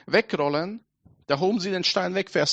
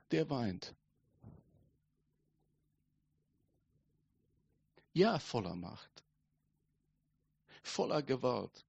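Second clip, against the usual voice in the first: soft, low, weighty questions; hushed, solemn preaching; 3 semitones lower, 5 dB quieter than usual. Real, not acted. Preacher asks who this weeping God is, hushed and solemn. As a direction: speak softly and leave long pauses between phrases.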